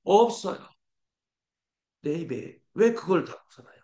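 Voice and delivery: usual speaking voice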